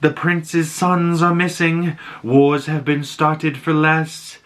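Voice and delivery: lightly acted creepy girl, slow and high; retold eerie girl. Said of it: "and she's like".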